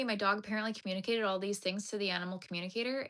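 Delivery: ordinary talk, nothing marked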